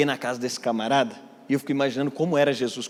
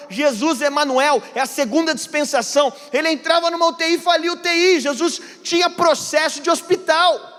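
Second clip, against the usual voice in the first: higher and louder, building fervour; fervent preaching; 11 semitones higher, 5 dB louder than usual. Acted. Pastor exhorting the congregation, loud and fervent. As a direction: preach it out to the whole church.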